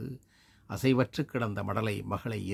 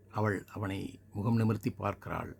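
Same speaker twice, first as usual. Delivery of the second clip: ordinary delivery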